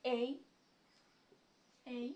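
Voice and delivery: letter A said as এই, like "ay"; এই stretched slightly as a teaching model; correct letter A. Shown as a demonstration. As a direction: say এই clearly and carefully as the letter A. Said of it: "সঠিক উচ্চারণ হবে এই".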